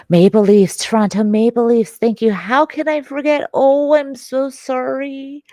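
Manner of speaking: loud, high burst of sudden recognition; excited outburst. Real, not acted. Streamer finally getting the team name from chat, excited outburst. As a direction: exclaim it high and loud, overcome with apology.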